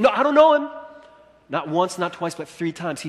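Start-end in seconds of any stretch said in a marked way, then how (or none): 0.0-0.7 higher, louder panicked denial; flustered denial. Retold or imitated. Peter panicking, denying Jesus.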